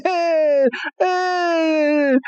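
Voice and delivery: high, laughing creature call; Lapras cry. Retold or imitated. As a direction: chirp it like a happy Lapras. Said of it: "And Lapras is like".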